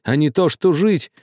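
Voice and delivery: high-pitched frantic reproach, building; agitated shouting. A narrator's reading. Old man rebukes Karlo for defying father, shouting. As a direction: cry out in horrified rebuke, pitch kept high. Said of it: "кричал весь, трясясь, старик".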